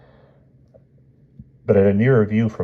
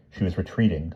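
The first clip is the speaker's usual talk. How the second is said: plain reading voice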